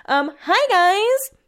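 chirpy hello to viewers; bright high hello. Real, not acted. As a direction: sing out hi guys to the camera. Greeting viewers mid-vlog.

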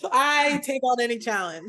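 laughing boast, loud and high. Real, not acted. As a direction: laugh it out proudly. Laughing after pirate bit.

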